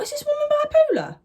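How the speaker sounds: very high incredulous squeal; hammed-up baffled outburst; mock disbelief. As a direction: squeal it in exaggerated bewilderment. Disbelieving thought about mum, retold.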